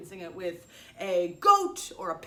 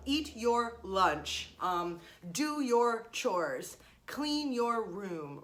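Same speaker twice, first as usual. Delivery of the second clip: slow spelled-out examples; much slower than usual. Shown as a demonstration. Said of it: "a verb, the word your, and then the noun".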